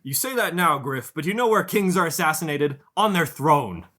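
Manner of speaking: high, loud, anxious protector pleading with his master; worried bodyguard. Acted. GM voices worried bodyguard Jason.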